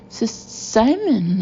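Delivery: slow stammered start on the name; horrified splutter. Acted. Boss caught with mouth full of muffin, horrified splutter. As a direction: choke out the name, caught in horror. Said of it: "I splutter muffin crumbs in horror".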